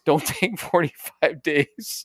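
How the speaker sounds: words broken by real laughter, very slow at first; can't keep a straight face while saying it; laughing through words. Real, not acted. Laughing while delivering his punchline.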